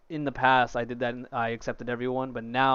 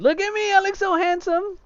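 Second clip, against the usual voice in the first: much higher, louder cartoon squeal; squeaky high voice; 6 dB louder than usual. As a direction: squeal it with vain delight. Admiring own character, squeaky.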